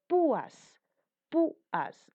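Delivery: "Púas" with a high, punched PÚ; stress on U. Shown as a demonstration. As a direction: hit the ú of "Púas" high, then let it fall. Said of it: "pero la cerrada tiene mayor fuerza de voz".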